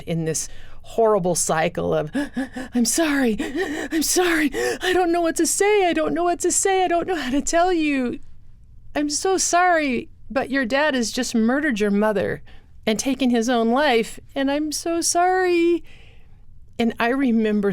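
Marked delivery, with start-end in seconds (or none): 2.1-2.7 choking gasp. Retold or imitated. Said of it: "she was crying so hard". 2.7-15.8 high, strained voice, choking out apologies through tears; retold choking sobs. A crying woman blurts out the murder news to a child, sobbing high. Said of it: "she sounded like she was choking".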